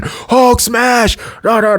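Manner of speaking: high, shouted Hulk battle cry; Hulk roar. Retold or imitated. Hulk smashing into the enemy base, roaring. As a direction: bellow it like the Hulk mid-punch.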